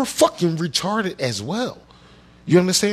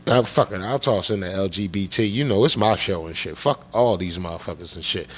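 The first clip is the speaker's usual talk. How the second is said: normal talking voice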